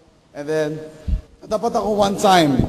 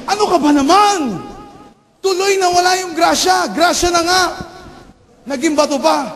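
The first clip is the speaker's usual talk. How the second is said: scolding mother; 11 semitones higher than usual. Retold or imitated. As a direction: rage at the lost blessing. Mother scolds child for lost blessing. (galit na sermon ng nanay sa anak)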